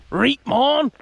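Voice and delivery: a high, hammed-up Shropshire "Reet, mon!"; mock Shropshire accent. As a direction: play a cheery Shropshire local greeting the post office. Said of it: "said in a famous Shropshire accent".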